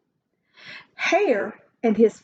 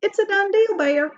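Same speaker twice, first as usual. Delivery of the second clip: smug Hare; 9 semitones higher, faster than usual. Acted. Hare seals the deal.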